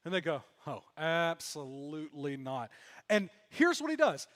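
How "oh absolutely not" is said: slow, low, drawn-out refusal; emphatic denial. Acted. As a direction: stretch it out, pitched low, as a weary no. Disciples answer the stranger's fishing question.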